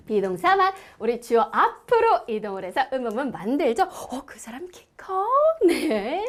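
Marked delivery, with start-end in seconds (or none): 3.8-5.3 hushed gossiping question; whisper. Acted. A friend whispering about a blind date, hushed. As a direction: breathe it like a secret.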